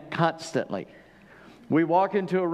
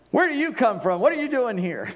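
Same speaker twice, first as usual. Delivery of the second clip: speaking roughly; 7 semitones higher than usual. Retold or imitated. Joseph to his brothers, a rough mock interrogation. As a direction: bark the questions at the strangers. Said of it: "he spoke roughly to them".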